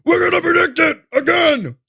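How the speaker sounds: shouted high cartoonish parody of a TV pundit; high mock-pundit shout, hammed up. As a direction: yell it like a Looney Tune loser. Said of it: "you sound like a fucking loser or a Looney Tune".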